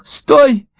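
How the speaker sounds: very high, frantic fish voice; high-pitched alarm from a tiny creature; panicked cry. A narrator's reading. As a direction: squeal desperate orders as if in danger. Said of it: "крикнула рыбка".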